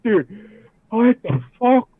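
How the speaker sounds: high, stunned exclamation; dazed shock. Retold or imitated. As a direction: blurt it out, still reeling. Answers brother dazed.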